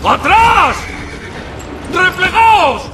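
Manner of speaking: grito agudo y fuerte de retirada; retreat order. Acted. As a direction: ordena replegarse a voz en grito. Orden de retirada en batalla. (orden de retirada gritada)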